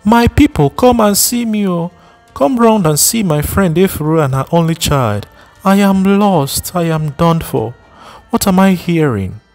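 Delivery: wailing shout. Acted. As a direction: call the whole village to come and see. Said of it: "There was a shout from a distance".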